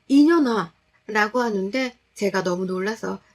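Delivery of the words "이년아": high, loud spat insult; sneering insult. Retold or imitated. Husband turning on his wife, loud sneering insult. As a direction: spit the word 이년아 at her high and loud. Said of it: "정확히".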